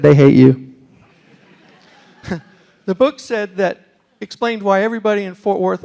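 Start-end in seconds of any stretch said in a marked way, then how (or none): 0.0-0.7 low, loud, deadpan punch line; blunt verdict. Retold or imitated. The book delivers the verdict on the Yankee, deadpan and loud. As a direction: drop it flat and heavy, like a verdict.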